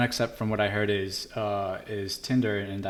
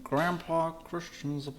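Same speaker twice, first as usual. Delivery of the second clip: lightly voiced old man, higher pitch; a hint of a creaky grandpa; 8 semitones higher than usual. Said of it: "That is a terrible old man voice".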